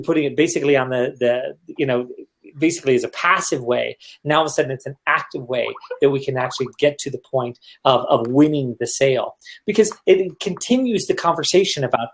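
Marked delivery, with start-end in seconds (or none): none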